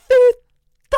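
screamed curse, very high and loud; pained scream. Retold or imitated. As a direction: scream out in sudden pain. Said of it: "jag var så här". (skriker svordom av smärta)

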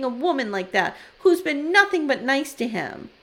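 wife refusing to talk, mock furious rant; angry teen rant. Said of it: "channeling my fifteen-year-old daughters here".

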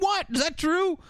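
high-pitched retold disbelief; mock gullible surprise. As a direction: squeak it out as a credulous question. Retelling a gullible character's surprise.

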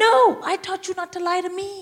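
high-pitched, exasperated telling-off; acted indignant correction; scolding mother. Mother scolding her crying daughter.

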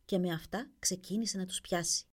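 ordinary delivery

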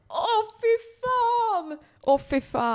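high, drawn-out groan of real dismay; horrified cry that leaps up, then settles; appalled outcry. Real, not acted. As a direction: wail it out, cringing at the memory. Appalled at memory of old selves.

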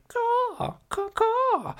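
very high-pitched bird-like squawk; mock monster caw. Imitating the monster of the air, high cawing. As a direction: screech the caw like a flying creature. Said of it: "making a noise like".

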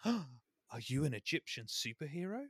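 lightly voiced wide-eyed child, soft; awestruck girl. Girl looks up at her rescuer, awed question. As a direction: ask it softly in mock wonder. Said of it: "the girl looks up to her and she goes".

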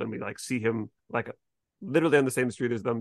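usual speaking voice

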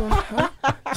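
high, puzzled huh; surprised huh. Retold or imitated. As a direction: react with a high confused huh. Confused reaction in story. (høyt forvirret hæ)

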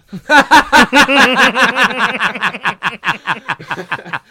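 big helpless laugh, high and loud; loud burst of laughter. Real, not acted. Telling friends about Mickey Rourke's gym, real loud laughter. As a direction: crack up loudly at your own story. Cracking up at the retold line.

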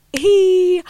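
high, drawn-out "hee"; long, high-pitched little squeal. Real, not acted. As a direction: let out a playful high squeal. Playful squeal after the podcast intro.

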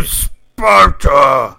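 huge drawn-out movie battle roar; mock war-cry shout. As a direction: bellow it slowly like a warrior king, hammed up.

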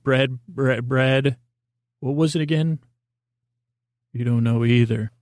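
ordinary talk, nothing marked

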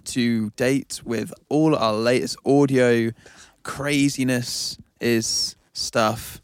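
slow announcer plug. Acted. Slow drawn-out sign-off plug.

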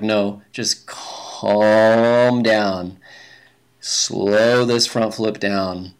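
long drawn-out, very slow words; self-calming. Retold or imitated. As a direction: stretch it out like talking yourself down. Retold self-talk, calming himself mid-air.

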